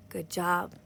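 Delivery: ordinary delivery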